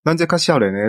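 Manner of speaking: usual speaking voice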